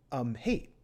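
usual speaking voice

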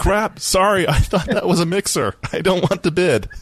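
flustered bidder. Acted. Accidental bidder backing out of the company sale, mock-flustered.

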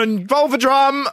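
high, drawn-out mock outburst; exasperated cry. Host demands drums in a drum fight, exasperated cry. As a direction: cry it out slowly in comic frustration.

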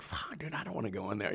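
hushed, scared mumble to himself; nervous mutter. Retold or imitated. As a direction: say it under your breath, losing your nerve. Nervous at the restaurant door.

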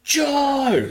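very high-pitched call across a crowd; Jake. Retold or imitated. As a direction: imitate hollering to a distant friend. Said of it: "a hundred and fifty meters away".

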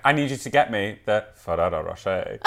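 imitated partner. Man repeating his chocolate request, imitated low and soft. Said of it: "And he was like".

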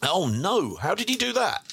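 high, swooping "oh no" reaction; dismayed disbelief. Real, not acted. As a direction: react aghast, pitch leaping up. Mock-dismayed reaction to injury news.

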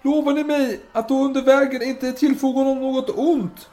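high, loud appeal to a crowd; calling out. Acted. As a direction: shout a solemn bargain to the mob. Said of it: "Och nu ropar".

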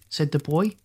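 usual speaking voice

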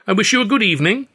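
high, quick mock politeness; bow out with fake charm; mock courtesy. A narrator's reading. Hurley bowing out to the rivals, mock polite. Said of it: "he beamed and gave a little bow".

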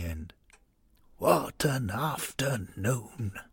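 put-on soft, slow consoling words of a frail old man; dying old man. Giancarlo consoling his weeping wife as he dies, soft and slow. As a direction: comfort her gently with failing breath.

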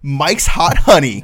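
grand product announcement. Acted.

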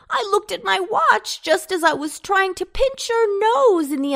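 high, loud, insistent protest; crying out. A narrator's reading. Potato disputes the seconds with Antone, crying out. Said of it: "cried Potato".

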